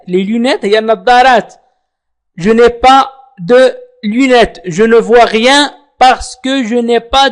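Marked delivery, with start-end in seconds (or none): 2.4-4.6 slow model phrase. Shown as a demonstration. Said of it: "teacher models French phrase".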